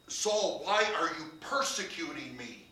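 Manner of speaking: loud, rising sermon build, slowing on the key words; full-force preaching climax; fervent preaching. Acted. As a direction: drive it home from the pulpit. Preacher voicing the Lord loudly.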